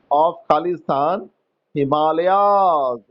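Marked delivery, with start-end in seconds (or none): 1.7-3.0 Himalayas drawn out very slowly; slow dictation. Shown as a demonstration. Teacher dictates a word to the class slowly. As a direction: say Himalayas slowly, one syllable at a time. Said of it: "dictating spelling to class".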